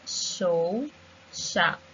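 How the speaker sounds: "Show" and "shop" with a long sh; sh examples. Shown as a demonstration. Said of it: "these words has the sh sound".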